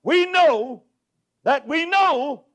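we know that WE KNOW, know hit loud and high; stress on know. Real, not acted. Preacher to congregation, stressing know. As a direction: lean hard on know, slowed down. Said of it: "we're gonna emphasize the word know".

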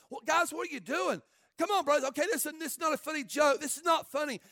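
loud, high, urgent pleading; panicked protest. Acted. Joseph begging his brothers as they tie him up, panicked protest. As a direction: plead with them to stop, rising into alarm. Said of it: "he's probably screaming back and yelling back".

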